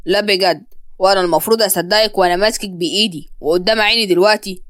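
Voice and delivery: low-pitched skeptical man; disbelieving male accuser. Acted. Murad mockingly disbelieves Yara.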